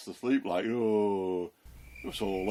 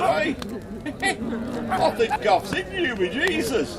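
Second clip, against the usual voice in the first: much higher, louder mock-accusing voice; accusing stranger; 12 semitones higher, 4 dB louder than usual. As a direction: put on a nosy bystander calling him out. Stranger accuses Peter by fire.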